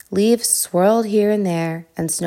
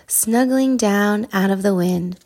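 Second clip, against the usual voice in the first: plain reading voice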